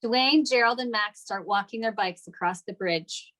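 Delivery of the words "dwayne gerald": ordinary talk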